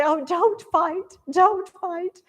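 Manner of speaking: high, drawn-out mock plea; imitating women begging both sides to stop; pleading women. Teacher voices women pleading between armies.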